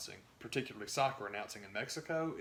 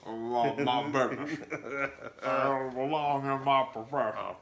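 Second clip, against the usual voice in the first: slow, louder, silly put-on dugout player voice; mock dopey player; 2 semitones higher, 6 dB louder, slower than usual. Mocking a player in the dugout, slow silly louder voice. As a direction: ham it up like a player mugging at the camera.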